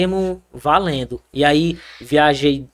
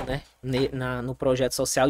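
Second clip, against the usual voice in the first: ordinary talk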